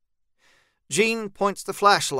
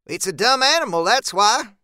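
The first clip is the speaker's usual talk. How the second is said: higher, louder impatient snap; snapping; 6 semitones higher, 6 dB louder than usual. A narrator's reading. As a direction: bite the words off at him. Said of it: "Zeb snaps".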